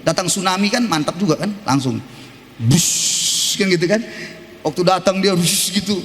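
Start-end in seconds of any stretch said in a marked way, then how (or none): 2.6-3.6 hissed wave whoosh; whooshing wave noise. Retold or imitated. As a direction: make the sound of the tsunami. Imitating tsunami wave sound. (bunyi ombak mendesis)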